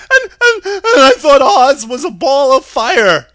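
very high, loud stammering lion; panicky, high-pitched cowardly beast. A narrator's reading. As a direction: yelp it out with a nervous stutter. Cowardly Lion voiced in story.

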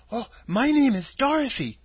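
put-on bright, determined girl; little girl voice. Dorothy answers.

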